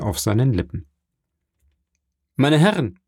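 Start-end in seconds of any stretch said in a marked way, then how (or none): 2.3-3.0 higher-pitched call to the guests. A narrator's reading. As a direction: call for everyone's attention. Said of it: "rief einer von den Gästen".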